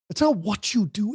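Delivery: ordinary delivery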